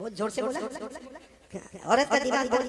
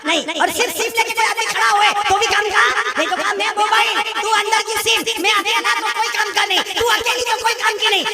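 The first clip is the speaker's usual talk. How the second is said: sweet-talking husband; 9 semitones higher, 4 dB louder than usual. Retold or imitated. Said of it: "इतने अच्छे से बोला".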